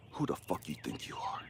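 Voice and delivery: very soft, low voice of God; low, quiet challenge; hushed stern rebuke. Retold or imitated. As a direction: confront quietly, almost under the breath. God's quiet rebuke, acted.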